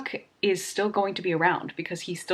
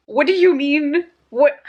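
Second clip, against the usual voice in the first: higher and louder, thrown by the news; stunned disbelief; 6 semitones higher, 7 dB louder than usual. Real, not acted. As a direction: demand it in sudden shock. Shocked by reaping twist.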